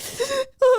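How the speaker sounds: high, sharp mock gasp of a scolded kid; imitated gasp. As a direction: gasp like a startled child. Child reacting to scolding.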